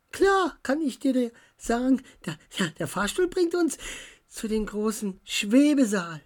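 chatty Dora explaining the lift; French girl. A narrator's reading. Dora introduces herself.

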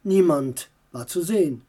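plain reading voice

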